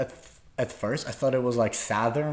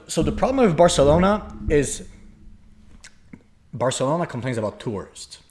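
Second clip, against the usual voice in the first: normal talking voice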